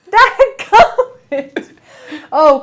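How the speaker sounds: laughing through the words, slow and high. Real, not acted. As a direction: crack up as you say it. Laughing at a viewer's comment.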